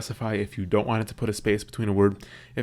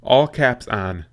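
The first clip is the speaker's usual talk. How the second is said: slow, loud, spelled-out command to the iPad; deliberate voice command, slow and loud; slow dictation command; 2 semitones higher, 6 dB louder, much slower than usual. Shown as a demonstration. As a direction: say each word slowly and loudly so the iPad catches it. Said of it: "So for example".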